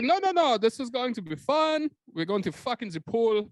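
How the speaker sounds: high, excitable German-accented impression; Verhoeven. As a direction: gush like a hammy director in a German accent. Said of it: "He's like".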